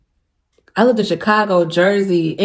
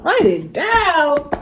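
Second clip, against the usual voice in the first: slow, teary echo; 8 semitones higher, much slower than usual. Retold or imitated. She repeats God's words, moved.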